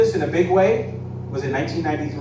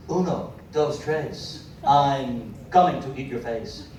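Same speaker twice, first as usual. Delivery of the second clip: Shatner voice; slower than usual. Retold or imitated. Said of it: "Shatner does the voice".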